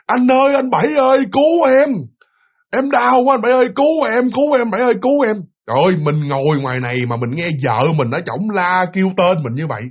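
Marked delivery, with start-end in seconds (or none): 0.1-5.3 high, loud desperate cry for help; wife crying for help. Retold or imitated. Dying wife screaming to her husband outside the door. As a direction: scream his name like you are in agony. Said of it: "giọng của bà Bảy la lên".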